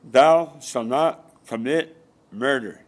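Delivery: very slow, drawn out like scripture; solemn intoned commandment. Acted. Preacher booming the commandment at his listeners, slow and solemn. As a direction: intone each word like a prophet handing down law.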